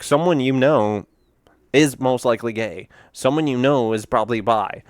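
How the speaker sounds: ordinary talk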